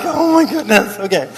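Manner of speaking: high exasperated gasp dropping to resigned okay; dismayed. Retold or imitated. Young pastor seeing the man return, high dismay. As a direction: sigh it out in disbelief. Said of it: "I'm like".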